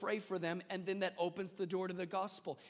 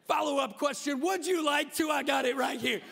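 much higher and louder, pumped-up; mock eager shout; 7 semitones higher, 8 dB louder than usual. Twelve-year-old [name] pitching the fisherman, pumped-up shout. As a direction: punch it out like a bold kid. Acts his bold young self.

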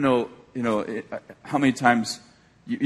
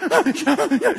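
mock high, gasping sobs; hyperventilating child; 12 semitones higher, 3 dB louder than usual. Granddaughter runs upstairs after a fight, hyperventilating. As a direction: gulp air like a hysterical child. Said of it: "she's hyperventilating".

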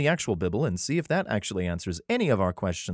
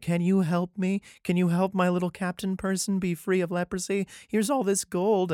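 higher, pleading little voice; whiny mock plea; 9 semitones higher than usual. Syrian king begging Israel's king, whiny mock plea. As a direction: beg like a fawning messenger. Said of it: "all these talents being like".

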